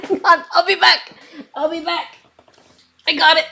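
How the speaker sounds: giggling through the words; laughing. Real, not acted. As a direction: laugh while you say it. Laughing after dropping pen.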